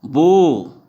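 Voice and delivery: बो loud, with a long "oh"; stretched vowel. Shown as a demonstration. Teacher showing o replaces w, बो drawn out loudly. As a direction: push and hold the ओ in बो. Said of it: "ओ आ जा रहा है".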